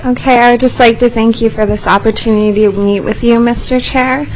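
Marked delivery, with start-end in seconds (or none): none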